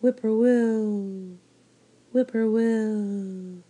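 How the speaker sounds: slow, drawn-out whippoorwill call; mournful whippoorwill call; bird call. Acted. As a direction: sing it out like a lonely night bird. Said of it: "A whippoorwill sang out".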